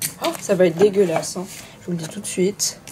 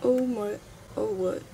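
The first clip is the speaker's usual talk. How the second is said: ordinary delivery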